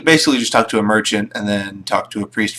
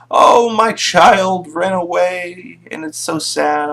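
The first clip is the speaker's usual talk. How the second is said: mock crying mother; 7 semitones higher, slower than usual. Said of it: "and she's crying".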